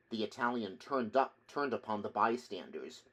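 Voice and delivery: plain reading voice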